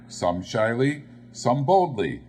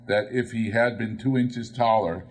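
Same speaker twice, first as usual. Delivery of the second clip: usual speaking voice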